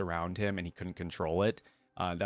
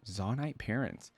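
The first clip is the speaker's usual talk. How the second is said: ordinary delivery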